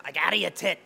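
loud, high-pitched impression; Scotty Lombardo. Comic doing a friend's catchphrase, loud impression. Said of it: "like, Scotty going".

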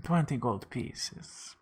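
slow, soft, drawn-out price; mock mystical. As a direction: say it slowly like a fortune teller. Fortune teller names price.

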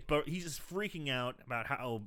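usual speaking voice

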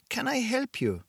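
put-on a calm woman with an accent hard to place; vague European accent. A narrator's reading. Shopkeeper greets Blake in a calm vague European accent. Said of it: "Her accent was impossible to place".

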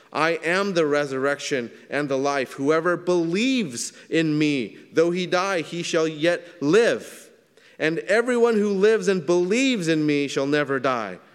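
high, loud, slow proclaiming of scripture; fervent preaching. Acted. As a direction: proclaim it with rising force. Preaching scripture at full force.

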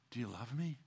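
Jesus asking softly, far away and tender; hushed. Acted. God gently asking the believer, hushed. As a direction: ask it almost under your breath.

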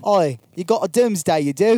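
high, loud mock Cockney; Cockney Superman. Friend redoes Superman in a mock Cockney accent. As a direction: belt it like a London street kid.

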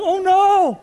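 a hammed-up cry of despair; mock bawling. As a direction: wail it like a man in tears, half-mocking. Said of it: "John was bawling like".

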